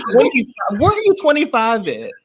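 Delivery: high-pitched disbelief; real incredulous challenge. Real, not acted. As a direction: demand it in high, scandalized disbelief. Disputing the woman's claimed age.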